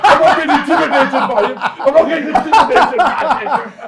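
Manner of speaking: chuckling defiance; laughing. Real, not acted. Laughing as he says he won't be intimidated.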